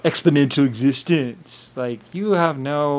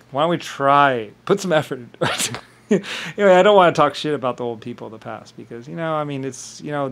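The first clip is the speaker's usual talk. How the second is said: ordinary talk, nothing marked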